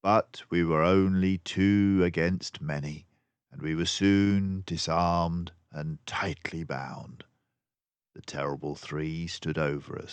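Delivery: ordinary delivery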